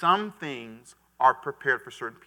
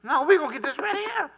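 mock wife putting her foot down, higher; playful high impression of the frugal wife; wife voiced, high and emphatic; 14 semitones higher, 7 dB louder than usual. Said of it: "She said".

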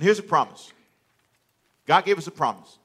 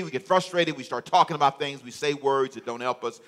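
normal talking voice

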